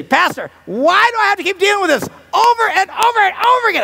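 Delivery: very high, shouted frustration; high-pitched hammed-up complaint; exasperated wife. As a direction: wail it in mock exasperation. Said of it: "nothing's more frustrating to a woman".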